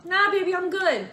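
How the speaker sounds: mock husband. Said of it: "And he'll say".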